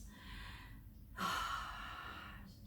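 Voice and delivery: long, airy exhaled sigh. Real, not acted. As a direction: let out a slow, settling sigh. Settling down for the practice.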